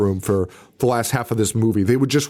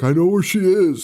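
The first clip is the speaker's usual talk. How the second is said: mock Courtney; 7 semitones higher than usual. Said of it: "Courtney's like".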